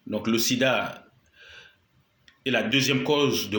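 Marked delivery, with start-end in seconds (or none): none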